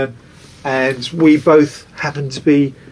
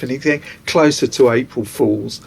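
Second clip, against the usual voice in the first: ordinary talk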